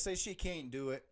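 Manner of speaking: ordinary talk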